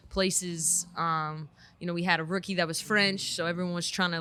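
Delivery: normal talking voice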